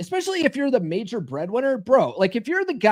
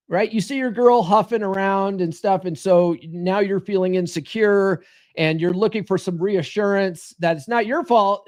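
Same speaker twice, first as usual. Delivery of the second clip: ordinary delivery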